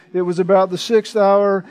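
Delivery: usual speaking voice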